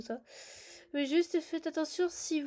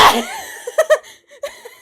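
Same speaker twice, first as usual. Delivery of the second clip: shrieking laugh; 11 dB louder than usual. Real, not acted. Game glitch shock.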